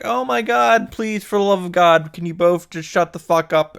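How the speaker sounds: high pleading outburst, easing down; hammed-up exasperated plea; mock exasperation. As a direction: beg them both, theatrically fed up. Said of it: "And then I'm like".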